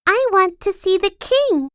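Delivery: put-on high, cheerful girl voice; sunny reply to the gloomy soldier; smiling Sunshine. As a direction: beam it out brightly, high up. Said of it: "smiled Little Miss Sunshine".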